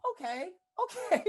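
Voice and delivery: teasing an aunt who is afraid of flat tires; mocking the auntie. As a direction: poke fun at the scared aunt. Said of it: "I'm like".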